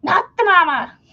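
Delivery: put-on high, loud cheeky baby voice; cartoon baby. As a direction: toss it back cheekily like the baby. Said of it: "looks back and goes".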